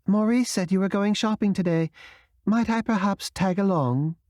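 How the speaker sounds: a hint of a wounded, proud Englishwoman; Madeleine with a British accent. Madeleine telling Camille about Marseille, lightly British.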